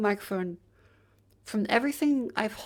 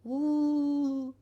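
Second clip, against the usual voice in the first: higher wailing ghost moan; 6 semitones higher than usual. Pretend. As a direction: wail like a cartoon ghost. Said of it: "This is Ghost [name]".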